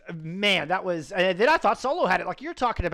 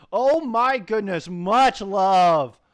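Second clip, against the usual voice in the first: loud gratitude; 6 dB louder, slower than usual. Real, not acted. Caster thanking donor loudly. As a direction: belt out the thanks.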